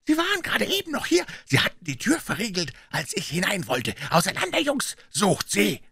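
high, strained calling; rally the men to the hunt; urgent command. A narrator's reading. Puh sending gang searching, urgent command.

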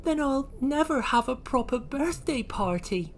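high, mildly woeful little voice; Oisin, near tears. Acted. Oisin despairs of a party, high and sad. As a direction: lament it in a high voice. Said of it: "and began to cry".

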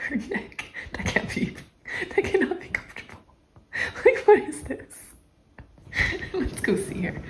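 hushed, laughing at the cat's odd pose; quiet giggling. Real, not acted. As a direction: giggle under the breath.

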